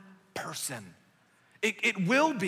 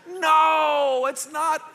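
mock exasperated shout; 8 semitones higher, 9 dB louder, much slower than usual. Exasperated retold protest.

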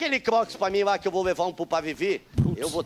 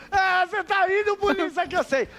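much higher, playful ribbing; teasing; 12 semitones higher than usual. Pretend. Teasing the policeman who keeps laughing, high and playful. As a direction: poke fun at him in a high voice.

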